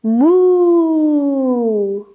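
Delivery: drawn-out playful moo; cow moo. Shown as a demonstration. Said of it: "Look to the ceiling for cow".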